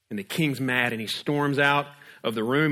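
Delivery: ordinary delivery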